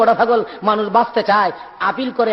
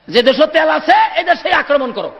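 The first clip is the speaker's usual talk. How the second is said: mock command; 8 semitones higher than usual. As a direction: call it out like a sweeping decree. Preacher mimics an attack order. (টেনে টেনে উঁচু গলায় হুকুম)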